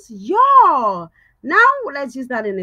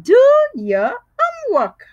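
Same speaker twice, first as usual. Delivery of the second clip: teacher voice; 7 semitones higher, 3 dB louder than usual. Shown as a demonstration. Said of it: "Your teacher tells you this always".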